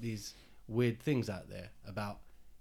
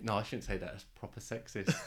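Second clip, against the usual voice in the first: usual speaking voice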